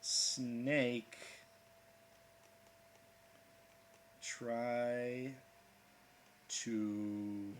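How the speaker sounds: slow, drawn-out codec call; lightly voiced radio handler, very slow. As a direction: stretch each word like a radio transmission. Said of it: "folks on the radio going".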